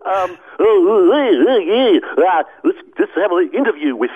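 lightly put-on interviewer; a hint of a talk-show host. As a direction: announce the interview. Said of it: "sounds a bit like".

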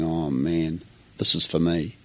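soft, inward moment of realisation; hushed inner thought. Retold or imitated. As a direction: say it quietly, a private realisation. Retold inner thought at camp.

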